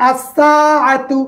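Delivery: আসসাআতু slowly, a doubled স and a long আ; careful demo. Shown as a demonstration. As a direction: spell out আসসাআতু slowly for the class. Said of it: "এখানে আলসাআতু নয়". (ধীরে আসসাআতু, স দ্বিগুণ)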